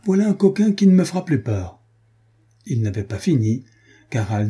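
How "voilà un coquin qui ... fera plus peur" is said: high, loud triumphant cry; exclaiming. A narrator's reading. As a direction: burst in through the window with the cry. Carquefou brandishes bloody dagger. (s'écrie fort et aigu, triomphant)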